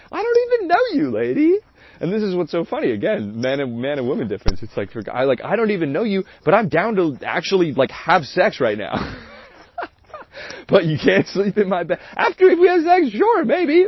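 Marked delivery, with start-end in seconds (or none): 0.1-1.6 high, squeaky mock exasperation; exasperated mock outburst. Telling the crying date he doesn't know her, high mock exasperation. 12.1-13.9 high, louder teasing shrug of a line; breezy, pitched-up mock offer; mock flippant offer. Offering his date sex but no sleepover, high flippant tease.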